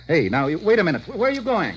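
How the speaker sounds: high, loud, startled calling after him; alarmed shout. Acted. Walt shouting after Rick to stop him leaving, startled. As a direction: yell to stop a man walking out.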